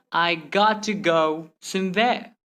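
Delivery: slow, "got" held apart from "to"; word-by-word. Shown as a demonstration. Teacher repeating the slow example, word-by-word. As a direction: separate each word. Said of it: "Instead of saying".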